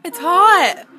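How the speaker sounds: loud, high cry-out over the burn; real pained yell. Real, not acted. Child reacting to the hottest pepper, real pained yell.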